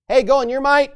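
loud, high-pitched call to action; rousing command. Acted. The Lord sends Gideon off in his might, rousing. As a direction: call it out to send someone off to battle.

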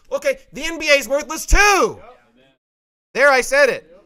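defiant outburst. Real, not acted. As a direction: fling it out defiantly.

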